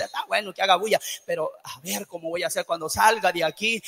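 low, soft aside; imitated private thought. Preacher voicing a distracted brother's thoughts during sermon, low mock musing. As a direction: drop low, as if thinking to yourself.

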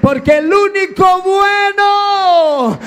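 shouted, high-pitched preaching, slowing at the end; preaching at full force. Acted. Preacher to the congregation, shouting at full force. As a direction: proclaim it to the whole church.

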